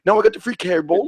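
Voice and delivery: put-on broken-English African accent; mock African accent. Host answering an African caller, mock African accent.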